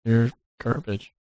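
slow, soft, flat dismissal; muttered slow put-down; deadpan put-down. Retold or imitated. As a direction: drop it quietly and slowly like a verdict. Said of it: "I was gonna be like".

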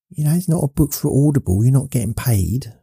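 lightly voiced high, quick naysayer; imagined critic. Imagined viewer reminding host of no pay, mock needling. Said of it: "some people might think".

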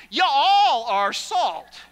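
slow, loud emphatic preaching; preaching at full force. Acted. Preacher booming to his congregation, slow and loud. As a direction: proclaim each word to the congregation.

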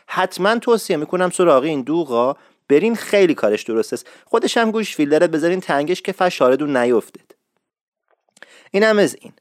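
usual speaking voice

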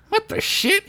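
high, startled cartoon voice; a squeaky, baffled little hero; surprised Bilbert. Narrator voices Bilbert's thought.

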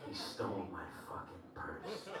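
low, soft, spaced-out meth lady voice. Retold or imitated. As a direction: drop it down and murmur like a dazed stranger. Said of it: "this meth lady comes up to me".

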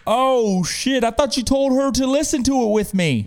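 loud, high mock alarm; retold shocked realization. Friend realizing he misunderstood the host, loudly alarmed.

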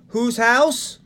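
slow high call, house rising for the crowd. Shown as a demonstration. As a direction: call it out slowly so they answer back. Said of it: "Whenever someone asks us whose house".

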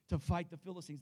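ordinary delivery

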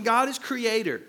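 usual speaking voice

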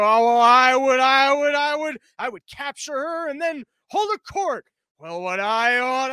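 loud blustering start, rising to high mock boast; old cartoon bluster. Another clubhouse man outdoing his pal, cartoon bluster. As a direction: keep winding up like a cartoon braggart. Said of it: "like in those old cartoons".

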